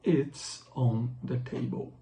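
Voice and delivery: slow, clear model sentences; slow repeat-after-me. Shown as a demonstration. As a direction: say each one slowly for students to copy. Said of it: "Repitam comigo". (devagar, para os alunos repetirem)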